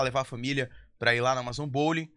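ordinary delivery

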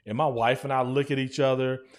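ordinary delivery

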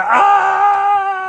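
very high, loud mock shriek; mock scream. Father pretending to be scared by his son, loud mock shriek. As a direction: shriek like you're terrified. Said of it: "And I was like".

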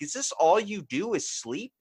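slow, high-pitched mock exasperation; exasperated disbelief. Bored watcher to sleeping dog, exasperated disbelief. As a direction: stretch it out in high, baffled complaint.

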